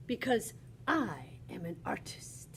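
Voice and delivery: breathy secret whisper. Pretend. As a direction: lean in and whisper it. Whispered aside as Matisse.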